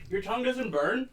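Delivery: usual speaking voice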